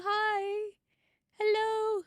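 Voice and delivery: usual speaking voice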